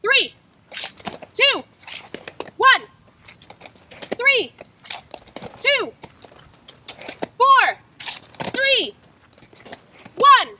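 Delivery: loud, high shouted numbers, each one falling; calling out lane numbers. Shown as a demonstration. Skater calling partner's lane during the drill, loud. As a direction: yell every lane like you're over a crowd. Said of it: "demo what that looks like".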